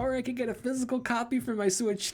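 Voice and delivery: very high comic whine, breaking into a laugh; Larry David. Retold or imitated. Host weighs the rival console option from a gif, Larry David style. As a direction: push the second option way up, mock-agonized. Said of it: "that Larry David gif where it's like".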